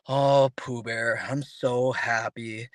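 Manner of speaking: slow, glum donkey drawl; Eeyore. Retold or imitated. Speaker mocks a gloomy donkey claiming to be happy, glum and slow. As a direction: drag out happy words in a gloomy monotone. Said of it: "It's like Eeyore".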